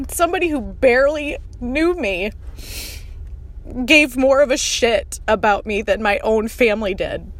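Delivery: crying while talking. Real, not acted. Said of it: "I'm crying".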